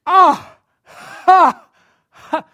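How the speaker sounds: huge high yelping laugh, drawn out like a sloth; imitating the sloth. Preacher mimics the Zootopia sloth's slow laugh, loud and high. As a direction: belt out a sloth's delayed excited laugh. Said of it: "and he goes".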